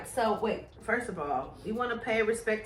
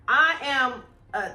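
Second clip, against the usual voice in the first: usual speaking voice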